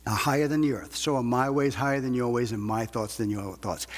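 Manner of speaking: normal talking voice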